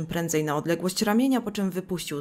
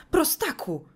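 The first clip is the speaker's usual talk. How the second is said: much higher, scandalised cry; outraged shriek; 8 semitones higher than usual. Acted. Mother Ida screams an insult at her son, high outraged cry.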